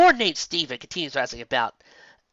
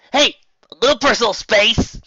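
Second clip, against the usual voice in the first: higher, louder playful complaint; annoyed protest; 10 semitones higher, 9 dB louder than usual. A narrator's reading. Dash to Applejack, higher playful protest. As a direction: wriggle free, mock-annoyed.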